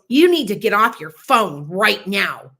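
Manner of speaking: retold clerk snapping at a customer on the phone; rude clerk's order. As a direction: order her off the phone, slow and loud. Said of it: "This guy goes".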